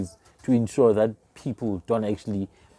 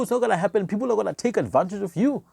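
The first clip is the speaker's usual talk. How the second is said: ordinary talk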